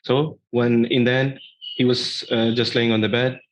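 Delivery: ordinary delivery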